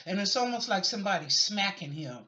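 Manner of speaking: ordinary talk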